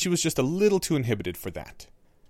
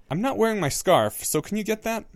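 put-on boy's voice; 9 semitones higher than usual. A narrator's reading. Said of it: "the boy ... said".

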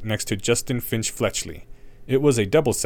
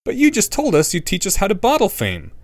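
put-on boy voice; 10 semitones higher, 4 dB louder than usual. A narrator's reading. Harry challenges Snape.

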